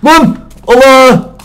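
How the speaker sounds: sudden high yell of alarm; loud panicked outcry; startled shout. Real, not acted. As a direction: blurt it out at full volume, caught off guard. Startled cry in game.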